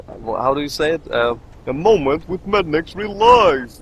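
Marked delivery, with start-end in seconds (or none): 1.7-3.8 loud, high show-title announcement; hammed-up title call, pitched up; mock segment announcer. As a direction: announce your own segment like a jingle. Mock segment title with cue music.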